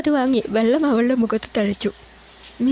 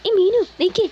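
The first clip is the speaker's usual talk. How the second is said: calling out; 9 semitones higher than usual. A narrator's reading. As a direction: call after her, alarmed. Najeeb stops her leaving.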